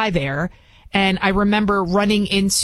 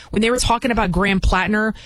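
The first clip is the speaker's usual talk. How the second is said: ordinary delivery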